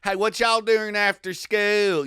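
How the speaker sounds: high, loud, slow Southern drawl; hammed-up Southern kid hollering; Southern accent. Imitating Southern schoolkids, high slow exaggerated drawl. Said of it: "the only kid that wasn't like".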